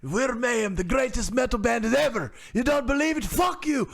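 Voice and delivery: shouty caricature of the band member; angry metal singer. Retold or imitated. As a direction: bark it at the interviewer like a furious rocker. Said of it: "reenacting the interview again".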